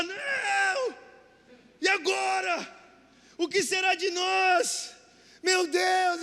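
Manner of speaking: hammed-up wail of despair; mock desperate shout. Pretending to panic at the fake priest, shouted. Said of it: "Começamos a gritar".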